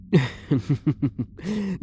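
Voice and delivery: a long, high, unhinged laugh that falls away; menacing laugh. Acted. Elf prisoner laughing madly after a threat. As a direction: let the madness slip out in a laugh.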